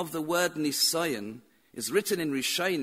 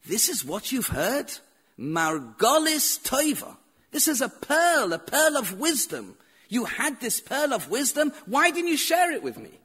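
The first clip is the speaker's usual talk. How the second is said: excited rebbe; 5 semitones higher, 3 dB louder than usual. Retold or imitated. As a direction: voice climbs higher and louder in delight. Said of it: "Rabbi Shua got so excited".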